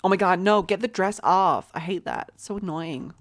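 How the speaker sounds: put-on lively American accent, lightly done. Dressing up her game employees, in an American accent. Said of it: "I promise I'm gonna stop now with the accent".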